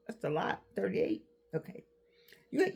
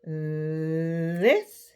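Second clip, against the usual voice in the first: mind slowing down; much slower than usual. Retold or imitated. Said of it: "It slows down".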